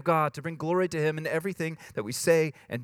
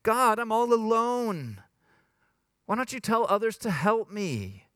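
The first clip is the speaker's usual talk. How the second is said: acted plaintive complaint, pitched up; complaining to God; 6 semitones higher than usual. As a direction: wail a self-pitying prayer. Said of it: "complaining to God".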